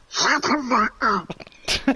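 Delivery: slow, very high squeaky duck voice cursing; ducky voice. Retold or imitated. Cussing at his wife in a high slow duck voice. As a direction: drag the curse out in a high cartoon duck squeak. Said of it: "doing it in a ducky voice".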